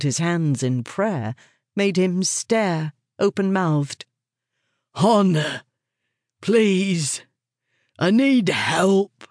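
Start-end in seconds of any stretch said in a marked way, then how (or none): 4.9-9.2 higher, drawn-out plea for help; pleading. A narrator's reading. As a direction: beg in character, stretching the words. Man begging on his knees.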